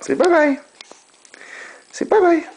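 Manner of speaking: high sing-song "bye-bye". Real, not acted. Father coaxes baby to wave bye-bye, high baby talk.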